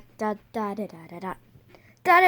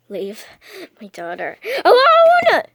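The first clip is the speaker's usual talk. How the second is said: mock angry yell; 6 semitones higher, 6 dB louder than usual. Mom yells defending daughter.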